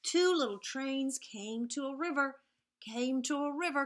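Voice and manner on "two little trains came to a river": normal talking voice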